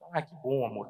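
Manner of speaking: soft, low, pleased wife on the phone; tender. Retold or imitated. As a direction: answer your husband fondly and gently. Voicing the wife's pleased phone reply.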